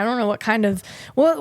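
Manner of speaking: ordinary talk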